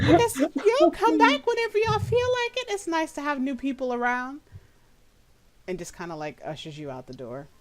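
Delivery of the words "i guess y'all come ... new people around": much higher, hesitant hostess voice; a nervous hostess piping up; Myrtle's high voice. Acted. Game master voices Myrtle asking why the party came, high. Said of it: "is like".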